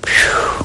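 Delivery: 小さく息を吐く「ふう」; whistled phew. Real, not acted. Amazed at the number.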